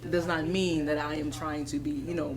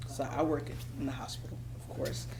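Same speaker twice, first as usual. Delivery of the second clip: whisper; 4 semitones lower, 6 dB quieter, faster than usual. Real, not acted. Drops voice starting workplace story.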